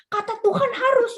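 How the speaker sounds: high mock child voice, whiny; mimicked child. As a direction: play the sulky kid quoting God back. Child mimicked answering parent back. (suara anak kecil yang merajuk, tinggi)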